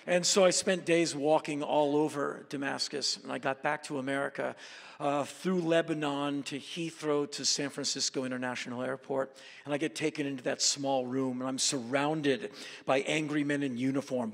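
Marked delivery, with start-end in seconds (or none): none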